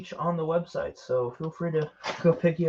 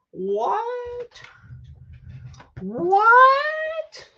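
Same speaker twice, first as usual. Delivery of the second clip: hammed-up squeak of surprise; mock shock. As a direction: act stunned by the plug. Mock shock at product plug.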